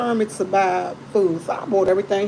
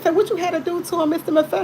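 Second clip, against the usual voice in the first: high, curious imitation of the doctor; doctor lady's voice; 8 semitones higher than usual. Sister mimicking the doctor asking her brother for details, high curious voice. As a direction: lift it way up like the nosy doctor.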